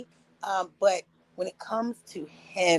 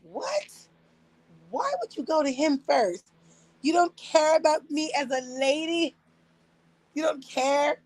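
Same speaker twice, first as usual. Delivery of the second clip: mock offended; 7 semitones higher than usual. Indignant at strangers checking on husband.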